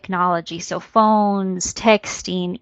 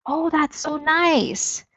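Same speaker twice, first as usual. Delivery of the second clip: higher, slow and sweet, truly pleased; sincere and interested; 7 semitones higher, much slower than usual. Shown as a demonstration. Presenter showing a listener who sincerely cares, warm. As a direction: sound genuinely glad for them. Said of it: "So I could say it like".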